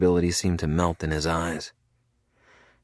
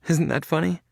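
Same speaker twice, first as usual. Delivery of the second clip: high, bitter rising question on isn't; mock the absurdity with a rising Isn't; 12 semitones higher than usual. A narrator's reading. Felicity telling Cade about the check, bitterly amused.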